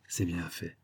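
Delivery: low, very soft repeat to oneself; aparté. A narrator's reading. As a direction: drop right down, almost under your breath. Same words repeated as aside. (en aparté, bas et très doucement)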